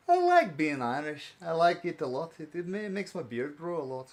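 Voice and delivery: playful put-on Irish accent; jokey Irish character voice. Shown as a demonstration. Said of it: "I can do Irish".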